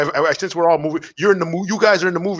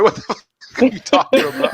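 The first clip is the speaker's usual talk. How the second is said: laughing disbelief; 9 semitones higher than usual. Retold or imitated. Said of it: "I'm like".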